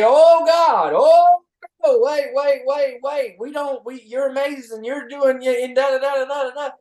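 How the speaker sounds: high, loud, gushing fake praise; mock fawning crowd. Host mocks people who only notice when you stop, high and loud gushing. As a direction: squeal like everyone suddenly begging you back.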